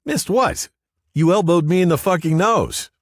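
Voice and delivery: very high, loud indignant protest; indignant yell. A narrator's reading. Larry protests to Riley about his elbowed nose, indignant yell. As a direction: yell it high in outraged complaint.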